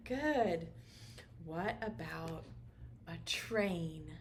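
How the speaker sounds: ordinary talk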